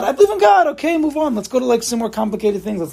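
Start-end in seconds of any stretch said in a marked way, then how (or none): 0.0-1.4 high, offhand brush-off; mock casual shrug of faith; glib mock believer. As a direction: toss it off like it's nothing. Mocking people who don't work.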